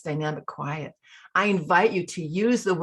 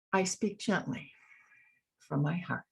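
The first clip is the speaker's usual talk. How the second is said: soft, gentle affirmation, much softer; speaking gently; 7 dB quieter than usual. Shown as a demonstration. Coach leading the group in a mantra, gentle and soft. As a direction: say it gently from the heart. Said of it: "Join me in that. Let's do that together".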